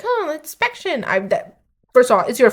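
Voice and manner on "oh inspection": high-pitched put-on announcement; mock inspector. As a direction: imitate a singsong surprise visitor. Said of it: "it was like".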